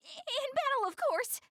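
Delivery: blurting a flustered excuse; flustered backpedal. Acted. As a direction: caught out, scramble to explain. Flustered backpedal after slip.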